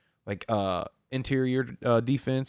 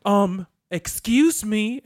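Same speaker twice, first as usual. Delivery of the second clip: imitating an offended flamboyant cashier, high and drawn out; slow, high-pitched mock indignation; 9 semitones higher, 3 dB louder, much slower than usual. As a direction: act out an affronted "excuse me". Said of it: "Very flamboyant".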